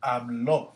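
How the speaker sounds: Habló with a stressed final ó; Spanish conjugation. Shown as a demonstration. Teacher stressing the accent for students. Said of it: "With an accent".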